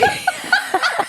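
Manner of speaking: high shrieking laugh. Real, not acted. Friend hearing the car story, high shrieking laugh. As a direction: burst into a high squealing laugh.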